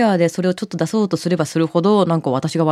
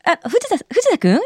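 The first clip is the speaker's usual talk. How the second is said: imitating Hana; 12 semitones higher than usual. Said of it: "とか言って".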